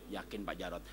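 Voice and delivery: low, soft wounded wife; low, soft wife confessing; wife's voice. Acted. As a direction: answer quietly, sure of it. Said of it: "suami saya selingkuh".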